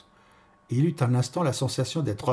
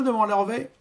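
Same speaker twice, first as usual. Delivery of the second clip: plain reading voice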